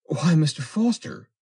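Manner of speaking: high, loud cry of protest; girl's exclamation. A narrator's reading. Girl to her suitor Foster, crying out in surprise. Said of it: "cried the girl".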